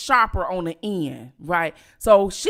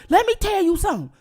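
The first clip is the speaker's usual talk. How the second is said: uncle warning; 9 semitones higher, faster than usual. Retold or imitated. Uncle arriving to confront.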